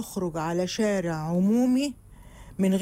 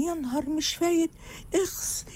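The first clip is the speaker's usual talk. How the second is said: high shocked exclamation; mock horror; 7 semitones higher than usual. Woman sees herself angry in the mirror, high mock disgust. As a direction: gasp in disgust at your own reflection. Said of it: "وبعدين".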